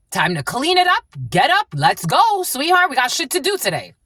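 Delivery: rally them out of bed; upbeat rousing. Real, not acted. Urging the viewer to get going, upbeat.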